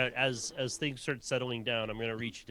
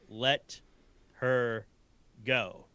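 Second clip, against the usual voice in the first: each word set apart by pauses; slow command; much slower than usual. Acted. Player casting suggestion on Ilby's father, slow command. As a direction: deliver it as a slow, weighty command.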